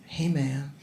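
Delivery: hushed, slow, caring question; quiet mock-tender concern; soft concerned whisper. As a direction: lean in and whisper kindly. Gentle check-in with seatmate.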